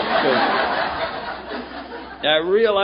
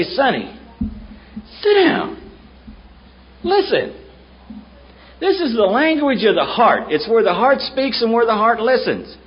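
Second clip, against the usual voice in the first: put-on old sponsor scolding Sonny at a meeting, firm and raised; 7 semitones higher than usual. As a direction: tell him to sit down and listen, with authority. Old man voiced in retelling.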